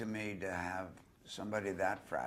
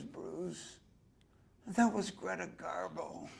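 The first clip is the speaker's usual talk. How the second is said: Marilyn voice; 11 semitones higher, slower than usual. Retold or imitated. Bruce recalls Marilyn naming the woman in the cab, high and awed. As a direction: breathe his name, then reveal the star, high.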